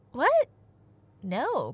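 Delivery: usual speaking voice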